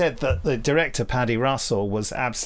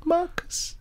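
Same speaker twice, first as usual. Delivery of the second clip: high, far-off call of a name; high mock call; 3 dB quieter than usual. Podcasters mimic the film's eerie call. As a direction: cry out the name like a ghost.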